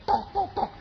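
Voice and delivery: light high spoken knocks, tok tok tok; quick playful knocking sounds; imitated knocking. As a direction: rap on the door with your voice. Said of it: "saya ketok".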